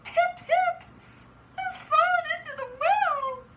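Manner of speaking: acted out-of-breath child, very high; gasping. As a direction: gasp out scrambled words high and loud. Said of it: "he gasped".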